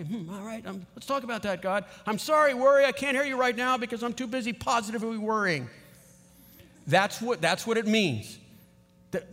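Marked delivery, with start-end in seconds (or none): 2.1-5.7 high-pitched, playful brush-off; cheeky, pitched-up excuse; mock apology to worry. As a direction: open loud and high, brushing worry away.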